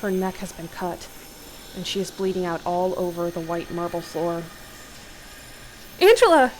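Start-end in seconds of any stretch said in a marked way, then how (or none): 6.0-6.5 high, loud cry of horror; horrified scream. A narrator's reading. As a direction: scream out your wife's name. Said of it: "you scream out in horror".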